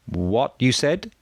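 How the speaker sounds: put-on slow, high call in foreign-accented English; broken English call. A narrator's reading.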